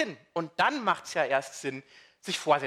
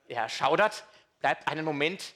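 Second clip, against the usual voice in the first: ordinary delivery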